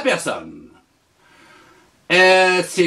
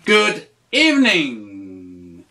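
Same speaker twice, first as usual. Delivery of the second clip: slow, lower repeat; 7 semitones lower, slower than usual. Shown as a demonstration. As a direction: repeat it slowly, a bit lower. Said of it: "tu dis".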